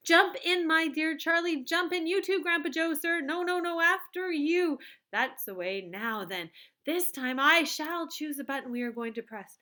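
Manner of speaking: high, loud eager chocolatier voice; excited Wonka. A narrator's reading. Wonka gushing to Charlie about winning. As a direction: bubble over with glee.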